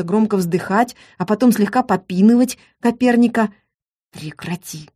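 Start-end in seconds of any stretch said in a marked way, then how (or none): 4.1-4.9 a soft hiss right into her ear. A narrator's reading. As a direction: hiss it close, barely voiced. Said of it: "прошипел он мне в ухо". (прошипел в ухо)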